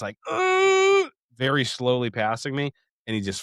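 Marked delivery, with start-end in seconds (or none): 0.3-1.1 a high, loud strained "ugh" mimicking the driver; mocking strained groan. Mocking the minivan driver flooring it, high strained groan. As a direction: groan like a man pushing a weak engine.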